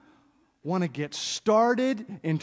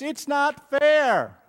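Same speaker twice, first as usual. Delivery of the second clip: put-on higher, slow childish whine; whiny kid; 7 semitones higher, 5 dB louder, much slower than usual. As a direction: drag it out like a sulking kid. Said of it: "when any kid says".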